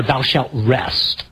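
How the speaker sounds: slow and deliberate, each word weighed; slow, grave decree; slow solemn command. Shown as a demonstration. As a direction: lay it down like a commandment. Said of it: "I just kinda sum up by saying".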